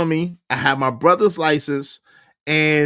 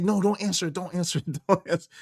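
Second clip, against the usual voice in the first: hushed pleading; 6 dB quieter, much faster than usual. Retold or imitated. Wife pleading quietly.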